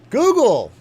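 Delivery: booming announcement. Acted. Streamer hyping a Google search, booming shout. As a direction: belt it out like a ring announcer.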